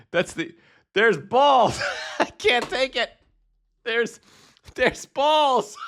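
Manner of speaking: squeaky, helpless laughter at the screen; cracking up, voice shooting high; real giggling fit; high-pitched laughing. Real, not acted. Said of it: "I'm laughing so hard".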